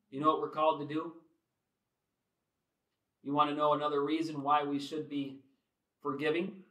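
ordinary talk

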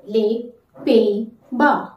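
Les Pays-Bas slowly, lay pay-bah; slow syllable-by-syllable model; slow French model. Shown as a demonstration. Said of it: "How to say?".

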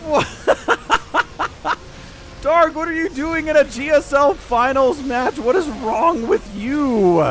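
mock exasperation. Real, not acted. Caster berating a pro player.

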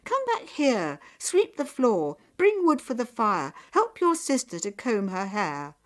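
loud, high scolding tirade; angry mother yelling orders; shouting mother. A narrator's reading. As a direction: bark a string of chores at the daughter. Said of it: "her mother shouted".

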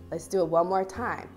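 normal talking voice

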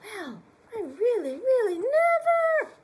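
high, loud exaggerated bear crying out; growly bear voice. Storyteller voicing the grumpy bear, hammed up. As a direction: ham up the bear's dismay.

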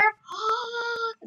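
high drawn-out gasp. Real, not acted. Streamer learns the boat goes under, delighted gasp.

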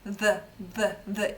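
«th» repeated, tongue tip flicked off the upper teeth; short energetic flicks of the voiced th; voiced th drill. Shown as a demonstration. Teacher shows students how to flick the voiced th. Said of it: "кінчик язика кладу на, верхні зуби".

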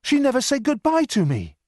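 put-on young man crying out for his lost love; crying wildly. A narrator's reading. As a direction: call out in disbelief and grief. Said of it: "I cried wildly".

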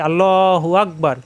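slow, drawn-out takbir as in prayer; prayer recitation. Shown as a demonstration. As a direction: stretch it as if calling the takbir in salah. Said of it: "আমরা নামাজে পড়ি". (নামাজের মতো টেনে পড়া)

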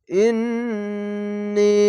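ইন্নী very slow, long humming "nn", then long "nee"; wajib ghunnah. Shown as a demonstration. Teacher showing ghunnah, ইন্নী with long nasal "nn". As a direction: hold the "nn" in ইন্নী as a nasal hum. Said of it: "এখানে ওয়াজিব গুন্না".

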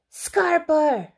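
high, loud warning; yelled. Acted. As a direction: yell it as an order to flee. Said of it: "yelled the zebra".

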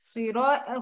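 usual speaking voice

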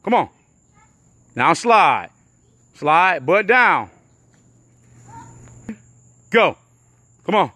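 loud coaching shouts across the field; coach yelling. Real, not acted. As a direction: bark the drill commands at full volume.

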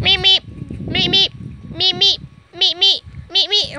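high, loud little meep noises; perky high-pitched meep-meep; playful meeping. Pretend. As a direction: beep out paired squeaks. Playful squeaking beside the dogs.

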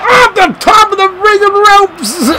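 high, excited play-by-play shout; high excited yell. Real, not acted.

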